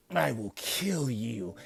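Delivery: slow, drawn-out threat; lightly voiced menace; menacing threat. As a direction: promise to kill him, slowly. Gaara threatens Rock Lee.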